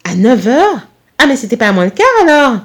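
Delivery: startled questioning, rising to a loud finish; flustered, alarmed mother; slightly panicked. A narrator's reading. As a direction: react with a touch of panic. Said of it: "l'air un peu paniqué".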